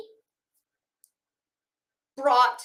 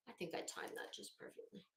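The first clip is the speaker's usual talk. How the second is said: a breathless whisper, far softer, lower; whispered aside; 5 semitones lower, 22 dB quieter, faster than usual. Real, not acted. Host whispering a smug aside to her viewers. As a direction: lean in and whisper it as a private gloat.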